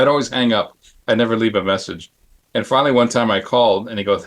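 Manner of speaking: ordinary talk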